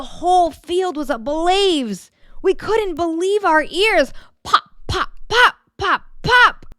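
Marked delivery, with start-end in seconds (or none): 4.4-6.7 rising, punchy pops, each one higher; popping sounds. Acted. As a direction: imitate corn popping, climbing up.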